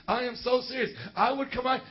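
normal talking voice

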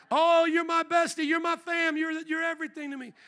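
high-pitched fake best-friend gush; mock gushing bestie. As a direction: gush loudly up high, then ease off.

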